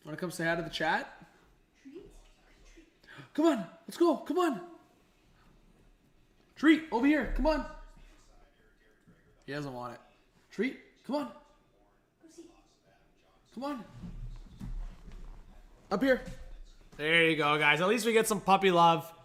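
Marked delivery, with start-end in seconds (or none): 3.3-7.7 high sing-song dog-calling voice; pitched up to lure the dog. Real, not acted. 10.5-16.2 high sing-song dog-calling voice; pitched up to lure the dog. Real, not acted.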